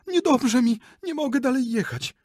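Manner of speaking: high, loud dying-lady wail; distressed cry. Acted. Lady on horseback cries she is dying, high and loud.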